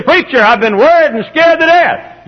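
imitating an anxious member; acted worried churchgoer. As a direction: wail it as an overwrought listener.

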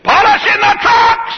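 slow, high, shouted Polishing the trucks; mock shout of the fire chief; hammed-up shouted answer. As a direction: yell it back like a proud fool. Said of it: "He said".

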